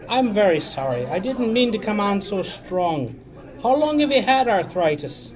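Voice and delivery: high, loud pastoral apology; apologizing priest. Acted. Priest apologizing to the drunk man, apologizing priest. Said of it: "nudged the man and apologized".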